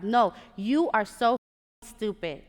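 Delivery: slow, loud, emphatic mock scolding; upset insult. Program director insulting her over stapled papers, upset scolding. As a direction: act out an angry boss cutting her off. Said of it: "He was so upset".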